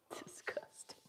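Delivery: breathy whispered disgust. Real, not acted. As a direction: whisper it, grossed out. Reacting to a comment in disgust.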